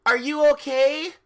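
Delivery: worried question. Acted.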